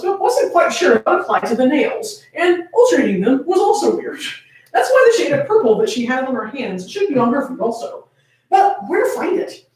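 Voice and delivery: plain reading voice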